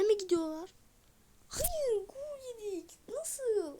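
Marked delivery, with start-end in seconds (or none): 1.5-3.8 high-pitched cry of disbelief; shocked disbelief. Real, not acted. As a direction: react like the lead just vanished. Conceding a goal.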